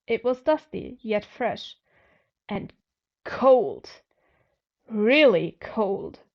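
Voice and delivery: ordinary delivery, nothing marked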